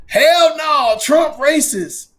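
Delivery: high, loud, slow mock protest; mock outraged voters. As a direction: yell it like an indignant crowd.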